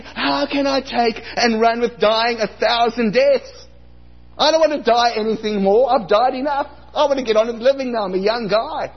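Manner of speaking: high, loud, protesting whine; a hammed-up indignant protest; mock outrage. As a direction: complain like a wronged young man. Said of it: "I was enraged".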